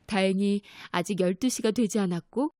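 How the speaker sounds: ordinary delivery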